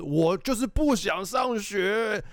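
slow, high, shouted refusal; child yelling he won't go to school, slow and high; defiant child. Retold or imitated. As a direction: stretch it out like a stubborn kid refusing school. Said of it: "他就是".

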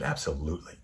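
low, soft, tender reply; gentle forgiveness. Retold or imitated. Woman forgiving the driver, low and gentle. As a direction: grant it softly, pitched low.

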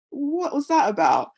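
as if the door just shut in your face; stunned disbelief. Retold or imitated.

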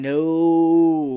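loud, long flat refusal; drawn-out firm no; emphatic no. Real, not acted. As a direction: shut it down hard. Disgusted at being called Daddy.

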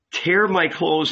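ordinary delivery, nothing marked

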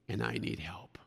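barely voiced cry for help; whispered plea. Preacher whispers the woman's plea for help. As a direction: whisper it, breathless and broken. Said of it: "Let me paraphrase that verse".

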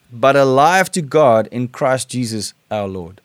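scripture reading. Acted. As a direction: read the verses with weight, like a sermon. Preacher reads scripture aloud.

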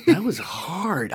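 laughing, drawn-out relief; slow, exhausted exclamation; exasperated relief. Real, not acted. Relief after hard summary.

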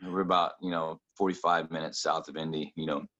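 normal talking voice

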